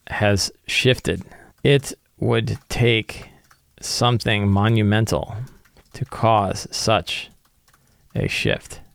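slow, hushed, ominous secret read aloud; slow dramatic reading. Acted. GM reading a secret to his notes, slow and ominous. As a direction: let each phrase hang, slowly.

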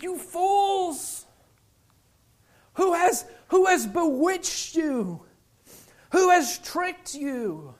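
high, drawn-out exasperated rebuke; Paul beside himself. Acted. Paul rebuking the bewitched fools, acted exasperation. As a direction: scold them slowly in high disbelief. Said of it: "Paul is beside himself".

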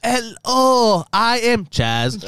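high, very slow stilted voice; mock robotic greeting. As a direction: say each word slowly and stiffly. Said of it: "Chaz is gonna talk to his brother".

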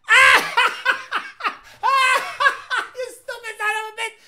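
high, loud, cracking up mid-line; laughing hard. Real, not acted. Streamer watching a guy blow his engine, laughing hard. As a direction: burst out laughing at him. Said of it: "I am laughing so hard".

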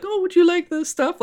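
imitating a polite girl on the train offering snacks. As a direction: offer snacks in a put-on dainty high voice. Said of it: "she's just this polite girl".

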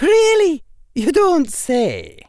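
high, slow dry sarcasm; sarcastic stern witch. Acted. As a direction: arch Scottish schoolmistress.

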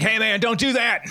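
high-pitched mock yell; retold yell. Lifeguard to a kid diving into shallow water, mock yelling. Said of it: "And I'm yelling".

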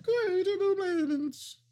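very high, slow falsetto segment call; falsetto announcer. Pretend. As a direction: squeal the segment title way up high. Segment title sung very high.

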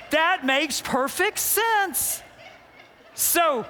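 ordinary talk, nothing marked

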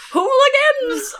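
put-on slow, high cranky old man yell; old prospector. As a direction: bellow it like a grumpy codger. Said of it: "That's what I picture".

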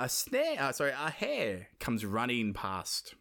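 normal talking voice

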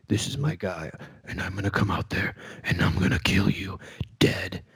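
low, hushed wrestling promo growl; mock tough-guy promo, dropped low and soft. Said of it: "like, promo voice".

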